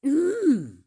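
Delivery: rising savoring hums; imitating slurping diners. Echoing the people on screen drinking happily. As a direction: hum like the contented diners. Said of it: "you can hear people say".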